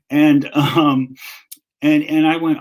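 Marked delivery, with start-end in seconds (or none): none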